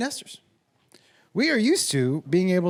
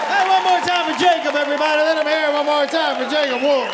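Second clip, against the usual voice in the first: raised, high projected host call; rallying the crowd; 7 semitones higher, 5 dB louder than usual. Real, not acted. Host calling for one more round for Jacob, projecting loudly. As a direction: call out over the applause.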